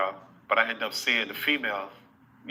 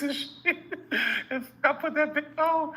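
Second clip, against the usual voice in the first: high laugh through the words. Real, not acted. Telling the listener, laughing high. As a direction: crack up as you say it.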